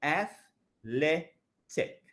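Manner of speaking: slow, drawn-out athletic, syllable by syllable; slow syllable demo. Shown as a demonstration. Teacher stretching athletic for students to count syllables. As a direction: spell out athletic slowly for counting. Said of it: "Three syllables".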